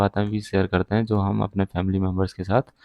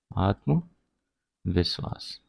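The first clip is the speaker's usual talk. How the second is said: आत्मविश्वास very slowly, softer, split in two; slow dictation; 5 dB quieter, much slower than usual. Shown as a demonstration. Teacher gives learners the meaning of confident, dictated slowly. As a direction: pause between आत्म and विश्वास.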